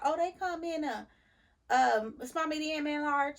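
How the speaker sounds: high, airy imitation of the cashier girl; lightly mock the girl at the counter; mimicking the cashier.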